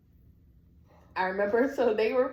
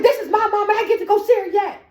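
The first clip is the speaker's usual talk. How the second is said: high, loud, rushed protest; indignant yelling; 11 semitones higher, 9 dB louder, faster than usual. Retold or imitated. Daughter to family blocking her mother's room, indignant yelling. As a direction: yell it, demanding your turn. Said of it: "they're tired of me yelling".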